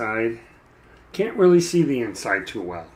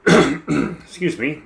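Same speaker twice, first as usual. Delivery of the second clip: clearing a hoarse throat; raspy, catching voice; 3 semitones higher, 7 dB louder, much slower than usual. Real, not acted. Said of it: "a little frog in my throat again today".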